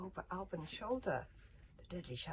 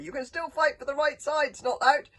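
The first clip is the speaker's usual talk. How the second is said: loud, high, hearty bellowing; calling out; 9 semitones higher, 17 dB louder than usual. A narrator's reading. Teacher urging his warrior to the right side, loud bellow. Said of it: "called Gobber".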